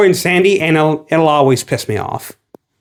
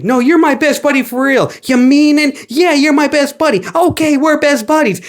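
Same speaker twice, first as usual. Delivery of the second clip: higher, gushing singsong; mock buddy voice; 8 semitones higher than usual. Mocking the dance-off buddy scene, lightly high gushing voice. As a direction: play it like a sappy kids' film. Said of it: "He's like".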